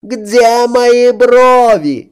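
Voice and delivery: wailing. A narrator's reading. Princess and king chorus.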